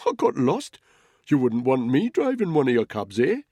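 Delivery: high, loud, cheery excuse; disarming friendliness. A narrator's reading. Caught snooper excusing himself to controller, disarmingly friendly. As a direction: bluff innocently through an open smile. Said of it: "an open smile to spread".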